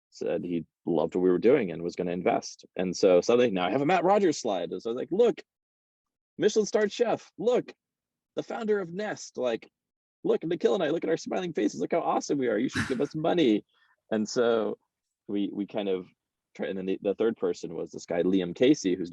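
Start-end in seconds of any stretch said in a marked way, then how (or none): 5.1-13.6 very high, gushing sales pitch; excited pitch. Retold or imitated. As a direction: hype yourself up to investors. Pitching himself to investors.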